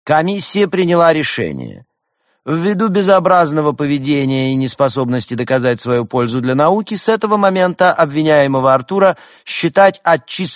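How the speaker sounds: loud, high official proclamation; formal announcement. A narrator's reading. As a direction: announce the verdict like a pompous chairman. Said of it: "Декан три раза стукнул молоточком и объявил".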